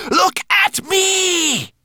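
very high, slow, loud screech; monster screech. Acted. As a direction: shriek the command.

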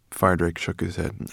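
plain reading voice

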